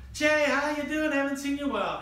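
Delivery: put-on high, loud gushing girl; excited greeting. Girl greeting an acquaintance in the cafeteria, excitedly. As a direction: squeal a hello at a long-lost friend. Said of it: "a girl I knew came up".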